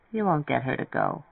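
ordinary delivery

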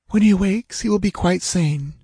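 hushed woman. A narrator's reading. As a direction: confide it in a high woman's voice. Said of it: "she whispered".

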